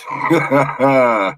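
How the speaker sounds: short chuckle trailing downward; laughing. Real, not acted. Host teasing about the Kool-Aid in chat, chuckling. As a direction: chuckle after your own joke.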